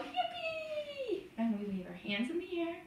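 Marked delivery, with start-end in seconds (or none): 0.0-1.2 high, squealed cheer without much volume; held-back playful yell for children; mock yell. Shown as a demonstration. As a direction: give a small, high celebratory whoop. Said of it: "we turn around and we yell".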